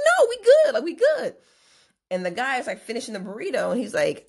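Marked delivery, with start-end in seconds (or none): 0.1-1.4 high, loud brush-off; exasperated, high-pitched refusal; loud protest. Retold or imitated. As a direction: shoot it down loudly, pitched way up. Brushing off the worried manager.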